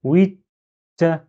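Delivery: "Oui" stopped on a t, pause, then "ta"; blocked t. Shown as a demonstration. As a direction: hold back the t before releasing "ta". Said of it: "je bloque ta".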